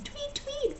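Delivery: very high, soft chirped tweet; birdsong imitation. A narrator's reading. Narrator voicing golden birds, high soft chirp. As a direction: pipe it like a tiny mechanical bird.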